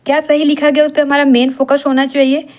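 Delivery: usual speaking voice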